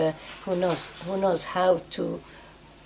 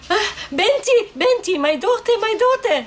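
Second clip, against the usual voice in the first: much higher wailing cry of a beaten mother; 13 semitones higher, 3 dB louder than usual. Retold or imitated. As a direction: call out to your daughter in anguish, pitched high. Mother voiced crying in story.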